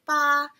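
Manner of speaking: BA held straight on a high level pitch; first tone. Shown as a demonstration. Teacher showing learners Mandarin first tone, BA high and level. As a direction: say BA high, prolonged and level, no change. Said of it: "like, high pitched. It's just straight".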